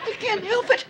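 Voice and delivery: high, tearful whine; in tears, unable to stop; crying. Acted. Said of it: "Don't, don't cry".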